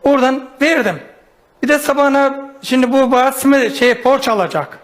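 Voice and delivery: ordinary talk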